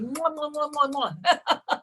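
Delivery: smacking kiss sounds; big wet smooch. Real, not acted. Blowing kisses to birthday caller.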